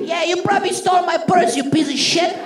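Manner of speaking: put-on high, scornful old lady voice; old Sicilian woman. Old fan accuses the Neapolitan comedian, scornful old Sicilian woman voice. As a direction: play an elderly Sicilian turned hostile. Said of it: "an eighty-year-old Sicilian came up to me".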